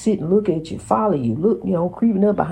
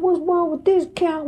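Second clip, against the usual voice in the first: high-pitched, retold bewilderment; baffled alarm; 10 semitones higher than usual. Telling how the cat stared unmoving, baffled and high. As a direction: act out your own puzzled reaction, pitched up. Quotes own startled reaction to cat.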